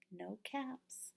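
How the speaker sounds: hushed whisper of dismay. A narrator's reading. Reading to children, a hushed no caps whisper. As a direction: breathe it out, caps gone.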